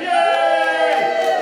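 loud high yay; excited cheer. Real, not acted. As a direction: cheer them on loudly. Cheering raised hands count.

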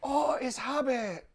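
high, slow starstruck fan voice; mock starstruck fan. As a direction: gasp it out like an awed fan recognizing the Hobbit. Fans spotting a celebrity.

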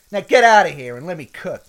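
loud, fast dismissal; brusque order. Acted. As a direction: chase the boss out of the kitchen. Cook orders the boss out.

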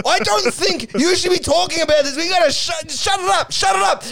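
high, hammed-up shouted plea; mock frantic hushing. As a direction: shout them down in a mock panic. Acted quarrel, demands silence.

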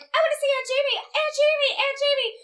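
put-on very high, loud child voice calling; very high childish call for her aunt; little girl calling. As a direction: whine like a small girl at the window. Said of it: "and she'll be like".

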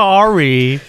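hammed-up Canadian accent; Canadian accent impression. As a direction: mimic a Canadian apology. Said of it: "is that your Canadian accent impression".

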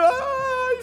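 high, loud comic bawl; exaggerated tearful "ai" wail; mock crying wail. Preacher reenacting hugging everyone, bawling in mock tears.

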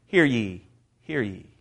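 usual speaking voice

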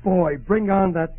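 loud, high-pitched eager hope; elated. Acted. Starving husband learns he can eat, elated. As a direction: leap on the news, loudly excited.

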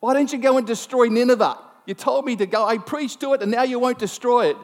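high, indignant complaint; acted outrage at being let down; Jonah. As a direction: protest hotly, pitched well up. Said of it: "it's a bit like Jonah".

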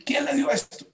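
the alarm climbs to near disbelief; shocked pharmacist. Acted.

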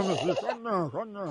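low, soft mock hushing; soothing a baby. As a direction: sink into a pretend lullaby-like aside. Feigns hushing a crying baby.

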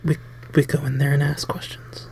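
breathy whisper. Pretend.

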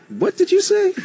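high-pitched, startled echo of the question; shocked disbelief. Retold or imitated. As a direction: voice the chief of staff jumping in, stunned. Chief of staff reacts in disbelief.

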